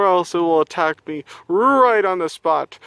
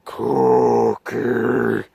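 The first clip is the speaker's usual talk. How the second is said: low monster growl; 5 semitones lower than usual. Acted. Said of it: "a wild cookie monster".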